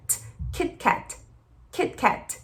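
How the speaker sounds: "Kit Kat" with breathy aspirated T's, whispered. Shown as a demonstration. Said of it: "Quando a gente aspira".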